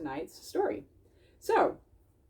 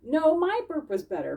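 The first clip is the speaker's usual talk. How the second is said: lightly voiced boastful little animal, higher; a playful brag in a raised character voice; Bink's voice; 7 semitones higher, 4 dB louder than usual. Bink bragging to Bismarck, light character voice. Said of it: "Bink said".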